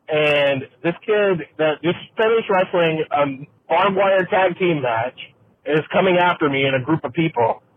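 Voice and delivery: ordinary talk, nothing marked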